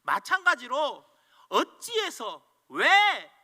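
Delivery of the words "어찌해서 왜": slow, louder "어찌해서 왜" with falling "왜"; resentful complaint. Pretend. Preacher mimics the resentful person, slow and heavy. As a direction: drag it out in grievance. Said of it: "원망하는 사람은".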